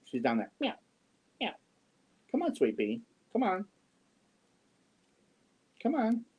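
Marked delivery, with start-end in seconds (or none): 0.6-1.5 imitated meow. Meowing at the cat. 2.3-6.2 high sing-song call to a pet; much higher coaxing come on; cooing to the cat. Real, not acted. Calling the cat.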